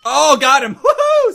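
very high, loud triumphant cheer; victory whoop. Real, not acted. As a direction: whoop with a laugh. Lands long distance kill.

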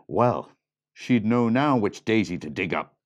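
ordinary delivery, nothing marked

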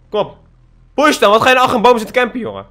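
fast, loud jeer at a camper; quick, loud mocking jeer; taunting. Real, not acted. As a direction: goad him out from behind the tree. Taunting a hiding enemy.

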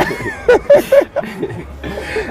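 a sudden shrieking laugh. Real, not acted. Host cracks up at the Kwawu London joke, laughing high.

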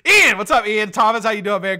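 loud, high burst of greeting; excited call-out. Real, not acted. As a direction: belt out the name as a welcome. Calling out viewers' names.

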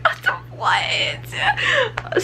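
high whispered gasp of surprise; amazed little whisper; stunned whisper. Retold or imitated. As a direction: whisper Oh, what in breathless disbelief. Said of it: "And I'm just like".

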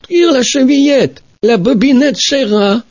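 put-on high, called-out old grandmother; grandmother calling. Grandmother in bed calling to the door, high. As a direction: call out from bed.

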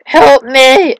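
mock cry for help. Player stuck in a game, mock panicked call. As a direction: holler in play distress.

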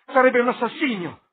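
frantic yell of no; desperate shout. A narrator's reading. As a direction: scream to save the painting. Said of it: "gridò".

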